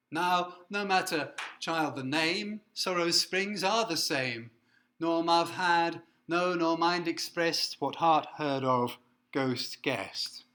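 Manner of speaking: slow, weighty reading of the last lines; solemn poem reading. Acted. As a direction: declaim the ending gravely. Reads the closing lines.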